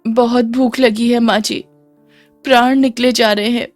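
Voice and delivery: acted, plaintive high plea; pleading. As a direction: beg meekly for a scrap of food. Starving daughter-in-law begs for food.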